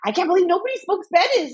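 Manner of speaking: put-on high-pitched incredulous child; amazed kid. Mom imitating son after getting the ball, amazed. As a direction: imitate a boy who can't believe his luck.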